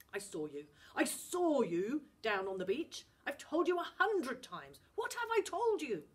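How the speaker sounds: put-on a father rounding on his disobedient boy; stern dad boiling over; angry Scottish dad. Dad scolds Finn.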